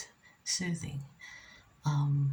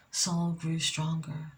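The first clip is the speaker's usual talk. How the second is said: ordinary delivery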